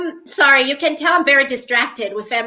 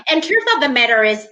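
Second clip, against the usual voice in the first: usual speaking voice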